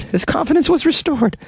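very high-pitched mocking echo; comic squeaky repeat. Host jokingly echoes the doctor's quote, comic squeaky voice.

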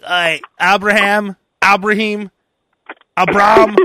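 "Abraham" drawled slowly, ending as "Abram"; mangled name. Retold or imitated. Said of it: "she's like".

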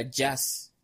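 "jazz" with a Y-like sound replacing L; imitated slip on "jazz"; mispronounced L. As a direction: say "jazz" for "las", the L slipping. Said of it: "La pronunciaba como doble L".

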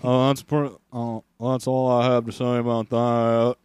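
slow, drawling Forrest Gump voice. Retold or imitated. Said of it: "Forrest Gump".

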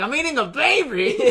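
high, loud, laughing dismay; hammed-up horror breaking into laughter; mock horror. Eater misunderstanding brother's reveal, mock horror. Acted horror at eating baby.

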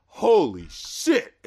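long drawn-out, floored exclamation; stunned awe. Retold or imitated. Album reviewer floored by a lyric, stunned awe. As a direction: stretch each word out in amazement.

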